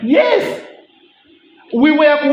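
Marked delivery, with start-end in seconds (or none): 0.0-0.6 eager yes. Retold or imitated. Groom answering eagerly.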